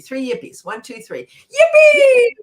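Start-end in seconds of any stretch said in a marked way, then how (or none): 1.3-2.3 much higher, shouted yippee; big yippee. Shown as a demonstration. Leader leading three yippies, shouting joyfully. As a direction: throw it up like you mean it. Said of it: "like we really mean it".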